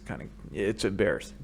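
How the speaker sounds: ordinary delivery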